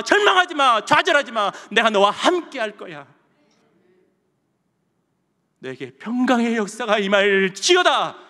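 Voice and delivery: loud, high-pitched preaching in God's voice; 설교자의 힘찬 선포. Acted. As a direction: proclaim encouragement forcefully, drawing out key phrases. Preaches at full force. (힘차게 외치는 설교)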